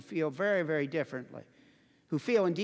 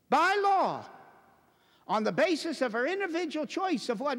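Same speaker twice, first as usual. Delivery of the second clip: indignant preaching; 5 semitones higher, 4 dB louder than usual. Real, not acted. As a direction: hammer it home phrase by phrase, outraged. Preaches at full force.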